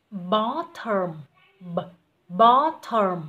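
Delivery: bottom said slowly and clearly, stretched out; slow careful demo of bottom; model pronunciation. Shown as a demonstration. Teacher modelling bottom slowly for the student. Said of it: "bottom nhá".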